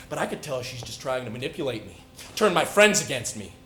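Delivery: ordinary talk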